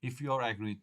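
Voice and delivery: ordinary talk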